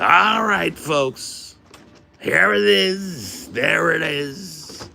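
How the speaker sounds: slow, loud, high Trump voice; Trump impression. Shown as a demonstration. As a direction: drag out each phrase like Trump. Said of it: "makes my Trump kinda okay".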